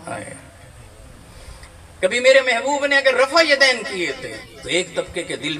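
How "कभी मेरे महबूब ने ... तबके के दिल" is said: much higher and louder impassioned preaching with laughter; fervent preaching. Acted. Preacher to congregation, soaring fervent preaching. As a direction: lift the hall with joyful fervour.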